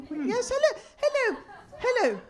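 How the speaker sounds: high, bright repeated hellos; mock eager hello. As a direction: wave them over gleefully.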